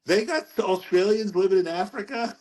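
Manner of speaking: high-pitched, amused astonishment; mock disbelief. Man retelling his surprise to his friend, mock disbelief. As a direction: replay your baffled reaction, pitched high. Acts out his baffled reaction.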